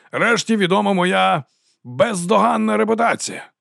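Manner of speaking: high, slow, grand declamation; ringing oration. A narrator's reading. Captain boasts of his reputation to troops, ringing oratory. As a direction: draw it out for the crowd. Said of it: "Його голос дзвенів".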